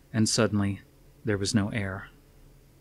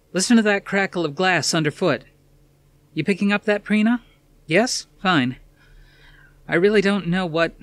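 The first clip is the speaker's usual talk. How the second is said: a lightly voiced TV reporter on location, a notch higher; news reporter on the ground, uneasy; tense field reporter; 9 semitones higher, 4 dB louder than usual. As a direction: report the scene live, tense and alert. Reporter narrating live scene.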